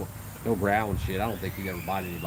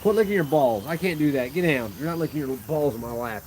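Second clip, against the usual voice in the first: higher, louder scolding; scolding the dog; 6 semitones higher, 5 dB louder than usual. Real, not acted. Owner scolding dog licking itself in his lap, louder and higher. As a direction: tell the dog off, half laughing.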